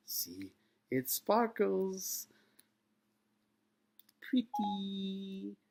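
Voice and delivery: high, very slow sing-song coaxing; coaxing an alien. Acted. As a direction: dangle a shiny thing, drawing out each word. Captain dangles badge before alien.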